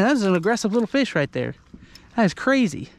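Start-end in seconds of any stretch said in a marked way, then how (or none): none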